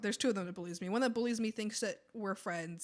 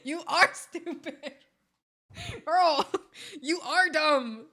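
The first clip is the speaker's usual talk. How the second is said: slow, drawn-out mocking verdict, louder; amused mockery; 5 semitones higher, 7 dB louder, much slower than usual. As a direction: stretch out each insult between laughs. Mocking coworker in retold thought.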